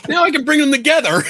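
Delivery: very high, giggly voice; laughing delight. Real, not acted. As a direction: squeal it through real laughter. Amused while finding the cards, laughing.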